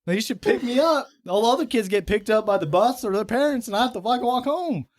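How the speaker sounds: put-on high, whiny kid griping about walking home; whiny kid rant. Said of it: "I'm like".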